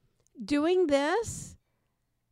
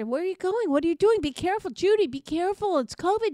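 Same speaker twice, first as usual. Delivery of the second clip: higher, louder, anxious calls after Judy; worried calling out; 7 semitones higher, 7 dB louder, faster than usual. Retold or imitated. Partner warning Judy from the car, loud and anxious. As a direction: call out after her, anxious.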